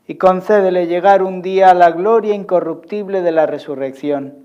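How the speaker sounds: loud, high intoned prayer; cathedral echo. Acted. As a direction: proclaim the prayer to the church. Priest proclaims closing prayer.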